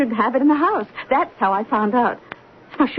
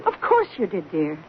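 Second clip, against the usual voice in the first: patronizing; 7 semitones lower, 4 dB quieter than usual. Acted. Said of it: "Will you quit patronizing me?".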